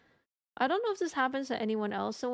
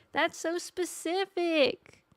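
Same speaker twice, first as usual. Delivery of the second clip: high, stretched-out disbelief; incredulous; 7 semitones higher, much slower than usual. Real, not acted. Streamer reacting to chat's oddly targeted prank, incredulous. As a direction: draw it out high, amazed at the absurdity.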